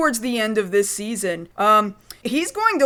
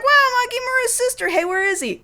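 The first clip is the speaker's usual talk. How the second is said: put-on much higher, quick, gushing child voice; excited kid voice; 10 semitones higher, faster than usual. Kids meet Makimura's sister: much higher, excited child voice. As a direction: play a kid thrilled to meet her.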